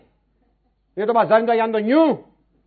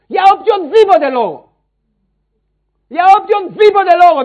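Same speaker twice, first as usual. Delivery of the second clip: loud, climbing pulpit insistence; preaching at full force; 5 semitones higher, 8 dB louder than usual. Acted. Preacher demanding the congregation forgive anyone, forceful preaching. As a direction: hammer the point home.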